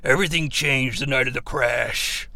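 low, hushed mock drama; melodramatic TV character. Recapper parodying TV characters recalling the crash, hushed melodrama. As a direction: confide it like a dark backstory. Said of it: "When characters are like".

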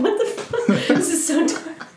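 sudden high squeal of disbelief, breaking into laughter; shocked disbelief. Retold or imitated. Viewer stunned by the dark shrimp story, high shocked squeal. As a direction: squeal it like you can't believe it.

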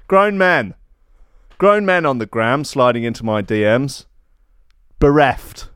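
normal talking voice

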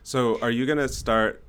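ordinary talk, nothing marked

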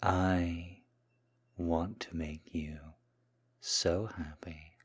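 soft, hushed, lingering murmur; submissive seduction. Acted. Kneeling man serving his Mistress, soft seductive submission.

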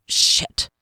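unvoiced curse hissed under the breath; frustrated curse. A narrator's reading. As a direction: spit it out, cutting off the count. Curse on fluffing the passage.

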